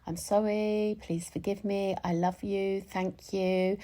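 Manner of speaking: softly recited, even and unhurried; gentle recited mantra. Acted. Speaker recites the Ho'oponopono phrases to herself, softly. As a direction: say it quietly, like a private mantra.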